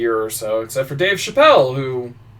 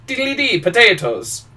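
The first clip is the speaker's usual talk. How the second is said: hammed-up Irish accent, higher; a cliché Irish accent lilt; mock Irish accent; 10 semitones higher, 3 dB louder than usual. Mock Irish accent, a stock Irish voice. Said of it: "do an Irish accent".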